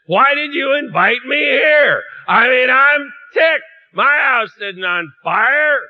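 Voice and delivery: loud, high, slow indignant complaint; ticked-off guest. Acted. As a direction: huff it out, offended and put out. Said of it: "your first reaction is".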